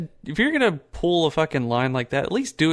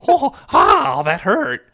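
high, loud cackling Joker line; hammed-up Joker laughing it off; 10 semitones higher, 6 dB louder than usual. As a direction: laugh through the pain like a villain. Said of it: "how the Joker could just keep laughing".

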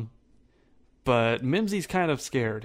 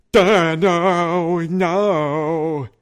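loud, higher, drawn-out oafish protest; giant's frightened wail; 5 semitones higher, 10 dB louder, much slower than usual. Acted. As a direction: wail it out like a big scared oaf.

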